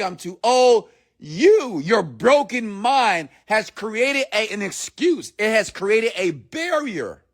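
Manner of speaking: high-pitched, insistent sermon delivery; impassioned motivational preaching. Acted. Coach challenging hesitant listeners to grab the wheel, impassioned preaching. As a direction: preach it high, leaning on key words.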